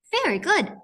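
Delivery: high cheerful praise. Acted. Praising children.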